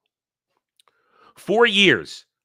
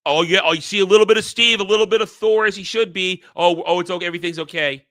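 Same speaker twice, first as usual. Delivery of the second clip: sarcastic mock relief; 6 semitones higher, 4 dB louder, faster than usual. Sarcastically voicing placated fans.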